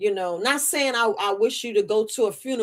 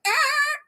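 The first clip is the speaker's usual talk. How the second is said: delighted high ah. Retold or imitated. Reacting to barbecue smell.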